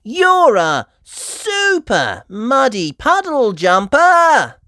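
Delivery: high, loud and slow; shower Daddy with admiration; excited praise. A narrator's reading.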